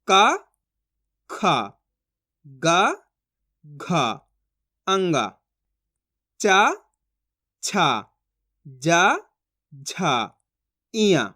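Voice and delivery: "का, खा, गा, घा, अंगा, चा, छा, जा, झा, इया" one by one, long pauses; letter drill. Shown as a demonstration. Teacher repeating letters for learners, spaced sing-song drill from "का" to "इया". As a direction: recite "का, खा, गा, घा" onward, alternately rising and falling. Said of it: "चलिए एक बार इसको repeat कर लेते हैं".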